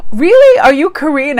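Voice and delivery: very high, loud surprised squeal, easing down; acted astonishment. Vlogger retelling her manicurist's question about being Korean, squealed surprise.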